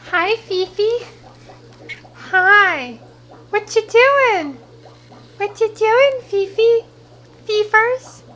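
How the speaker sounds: high cooing pet voice. Real, not acted. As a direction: coo up high to the cat. Cooing to her old cat.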